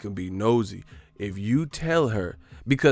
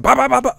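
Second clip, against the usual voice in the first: loud high imitated body-shot punches; mock punches landing, loud and high; punch sounds; 10 dB louder, faster than usual. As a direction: bang out the punches. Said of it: "He said".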